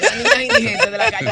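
breaks into laughter on the phrase; la frase se le escapa entre risas, mucho más aguda; laughing. Real, not acted. Laughs while telling the case. (riéndose a carcajadas)

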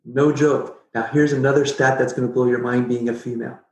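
ordinary talk, nothing marked

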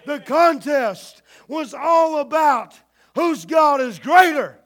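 slow, loud, hammering each word, all and greater punched; shouted climax. Acted. Preacher to the congregation, slow shouted climax. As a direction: drive the point home at the top of the voice. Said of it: "emphatic climax of the point".

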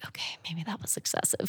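soft, voiceless aside; whispered admission. Retold or imitated. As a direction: whisper it like a private second thought. Re-enacts her sheepish second thought.

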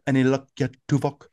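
lightly voiced character impression; Chakotay. Shown as a demonstration. Impressionist giving his key phrase, light character impression. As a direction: slip into a hint of his accent on a key phrase. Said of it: "that's Chakotay".

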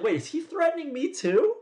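high, laughing double take; alarmed surprise. Retold or imitated. Teen realizing the threat may include him, high surprised. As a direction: catch yourself mid-thought in mock alarm. Retelling a sudden alarmed thought.

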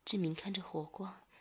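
ordinary delivery

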